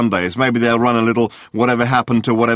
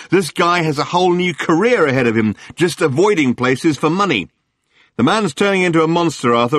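gushing tabloid hack; 7 semitones higher than usual. Acted. Journalist Murray greeting Arthur on the phone, gushing. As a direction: lay the flattery on thick. Murray voiced, high and loud.

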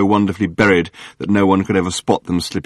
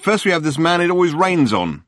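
gushing tabloid hack; 9 semitones higher than usual. Acted. Journalist Murray greeting Arthur on the phone, gushing. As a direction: lay the flattery on thick.

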